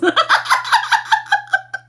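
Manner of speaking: real laughter that jumps high; squealing with laughter; high shrieking laugh. Real, not acted. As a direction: let the laugh break up high. Laughing fit after request.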